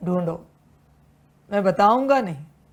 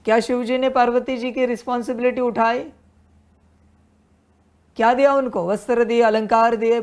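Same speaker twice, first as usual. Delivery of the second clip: louder, faster, higher fiery sermon; rapid-fire indignant preaching, pitched up; preaching at full force; 4 semitones higher, 5 dB louder, faster than usual. Acted. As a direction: hammer each question home like a preacher on fire. Fiery rhetorical questions on love.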